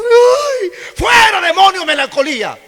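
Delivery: very high, loud hammed-up rebuke; mock exorcist shout. Preacher mocks rebuking a crier's melancholy, in a loud high mock shout. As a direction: shout at the sadness like a zealous exorcist.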